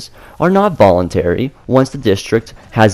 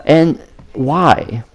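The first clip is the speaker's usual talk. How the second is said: strangled voice; 6 semitones higher than usual. Real, not acted. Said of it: "you sound like you're being strangled right now".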